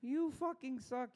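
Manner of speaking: high, put-on heckler voice; squeaky mocking taunt. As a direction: sneer it like a drunk heckler. Mock heckler voice.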